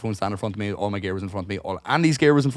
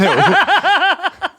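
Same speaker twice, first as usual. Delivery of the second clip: loud, high laugh breaking out; real laughing fit; high burst of laughter; 10 dB louder than usual. Real, not acted. Laughing at Margaret's guess.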